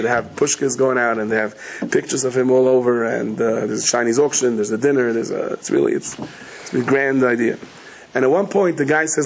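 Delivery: ordinary talk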